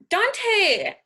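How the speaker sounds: high, loud call cutting him off; sharp shout. Acted. Pow silencing Dante in anger, loud. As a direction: snap his name to stop him.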